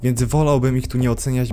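ordinary delivery

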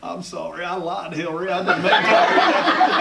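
put-on Southern voice, swelling to a loud, stretched finish; hammed-up presidential apology, slowing at the end; Bill Clinton. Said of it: "you sound like Bill Clinton".

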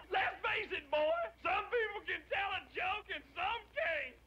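high-pitched hilarity; laughing punchline. Acted. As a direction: crow the punchline, cracking up. Punchline of his own joke.

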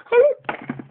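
hello shot up into a shrill cracked squeal. Acted. Mock-Afghan vlogger showing his broken voice, a squealed hello. Shrieked hello, the sound breaking.

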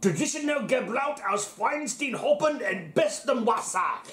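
put-on slow, high, hammy German accent. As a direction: read it like a proud Bavarian. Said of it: "Give me a chance to get my German accent out".

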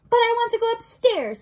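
high, loud wail; childlike protest. Acted. Mig protests to the rat, high childlike wail. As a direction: whine it loudly, pitched right up.

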